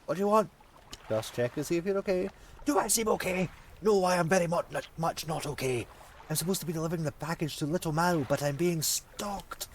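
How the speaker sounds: tense, wary stranger; jittery courier voice. Acted. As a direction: play a paranoid courier. Voices frantic courier NPC.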